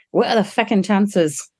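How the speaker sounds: put-on slow Irish accent slipping into Scottish; botched Irish accent. Quoting an Irish colleague's amazed question, in a botched Irish accent. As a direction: attempt an Irish accent, landing closer to Scottish. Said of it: "That was more Scottish".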